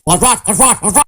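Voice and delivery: shouting monkey brothers. A narrator's reading. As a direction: chant it like jeering jungle monkeys. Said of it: "The Wickersham brothers came shouting".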